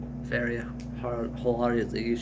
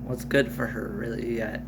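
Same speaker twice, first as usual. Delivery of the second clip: ordinary delivery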